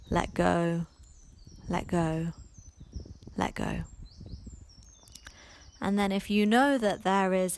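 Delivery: unhurried meditation voice with long pauses; guided meditation. Acted. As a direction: ease the listener into letting go, phrase by phrase.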